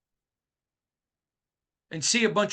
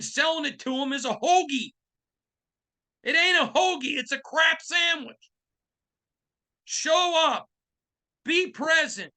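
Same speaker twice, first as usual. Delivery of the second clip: heated rant; 7 semitones higher than usual. Real, not acted. Host rants at the Raiders organization, high and shouting. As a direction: let them have it, shouting.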